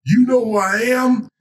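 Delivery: very high, crowing question; high-pitched showman's challenge; Muhammad Ali. Acted. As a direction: ask it like a champ fishing for praise. Ali answers the old man.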